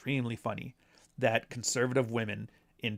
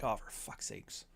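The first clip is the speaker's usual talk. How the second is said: whispered, slow oh for fuck's sakes; exasperated whisper; 2 semitones lower, 13 dB quieter, slower than usual. Retold or imitated. Reacting to tired culture-war gotchas, exasperated whisper. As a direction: breathe it out, fed up.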